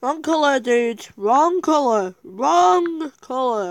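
loud exclamation. Real, not acted. Exclaims over picking the wrong colour.

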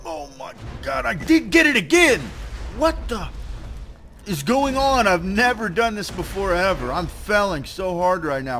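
high, loud outburst easing off gradually; exasperated disbelief. Real, not acted. Fails the jump again.

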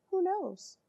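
ordinary delivery

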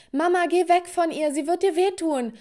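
high, loud frantic plea; alarmed warning. Acted. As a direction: cry out to warn mum of danger. Terrified child warns mother.

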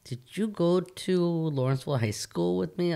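high-pitched, puzzled put-on voice; imitating puzzled classmate. Reunion classmate questioning a stranger, imitated as puzzled. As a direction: ask like someone eyeing a stranger oddly. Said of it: "They were looking at me funny".

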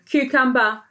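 usual speaking voice

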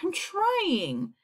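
high, small pleading voice; poor glowworm. A narrator's reading. Poor glowworm to impatient creatures, high and pleading. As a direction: answer high, as a put-upon little creature. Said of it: "answered the poor glowworm".

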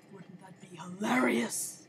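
ordinary talk

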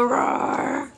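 playful growled roar; lion roar. Pretend. Mother asks baby if he roars, playful roar. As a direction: pretend to be a little lion. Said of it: "Do you go".

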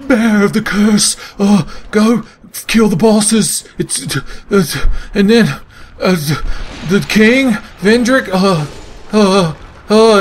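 halting, high-pitched mock of a game guide; Emerald Herald. Gamer mocking the Emerald Herald's opening speech, high and halting. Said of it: "and she's like".

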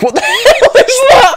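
high shriek breaking into laughter; loud, high laughing disbelief; laughing shock. Real, not acted. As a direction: yell it as you crack up. Startled by something in game.

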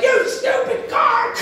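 high, slow mock shout at the car; hammed-up yell at a stupid car; mock angry yell. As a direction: yell at the car in fake fury. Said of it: "I wasn't like".

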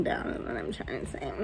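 fast whispered afterthought; whispered aside. Real, not acted. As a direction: mutter it under your breath. Quick whispered self-correction.

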